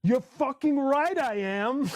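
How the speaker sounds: a hammed-up rock-star boast. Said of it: "In true [name] fashion".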